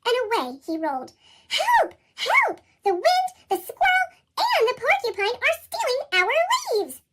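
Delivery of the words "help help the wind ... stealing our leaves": high, loud, panicked cries for help; crying for help. Acted. Fletcher calling for help as the squirrel steals the leaves, high and loud. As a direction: shout for help as the leaves vanish. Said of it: "cried Fletcher".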